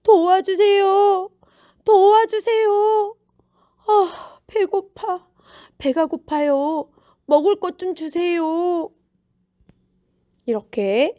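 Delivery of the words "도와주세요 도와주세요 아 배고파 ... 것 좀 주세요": high-pitched, hungry beggar's plea; drawn-out pleas easing into hungry complaint; begging for help. A narrator's reading. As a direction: beg passers-by in a high, needy voice. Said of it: "사람들에게 도움을 구해야".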